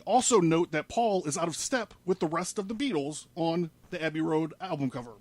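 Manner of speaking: normal talking voice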